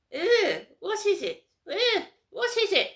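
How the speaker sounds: high, loud, sniffy posh squeal; posh disgust. Retold or imitated. Mocking snobs who look down on her, in a posh voice. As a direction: turn your nose up like a snob.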